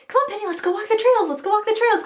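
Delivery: high coaxing call to the dog; high bright call to Penny. Real, not acted. Calling dog toward the trails.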